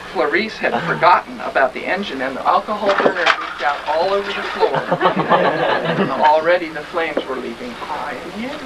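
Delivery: usual speaking voice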